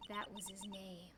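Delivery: soft unvoiced whisper. Real, not acted. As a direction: breathe it like a secret thought. Inner thought, whispered.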